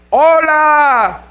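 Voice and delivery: high, long-held call. Retold or imitated. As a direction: holler hello to someone far off. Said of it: "estás gritando".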